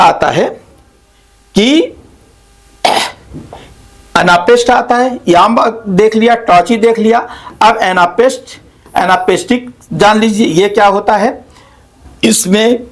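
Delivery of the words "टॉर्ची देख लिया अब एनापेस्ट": ordinary talk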